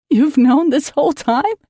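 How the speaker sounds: loud, shocked outburst; crying out. Acted. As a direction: cry it out in disbelief. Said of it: "I cried out".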